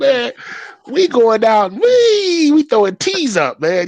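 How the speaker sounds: very high and loud hype; telling the family they have Tongan roots; thrilled announcement. Retold or imitated. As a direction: shout the big news to the family. Hyping family about rugby.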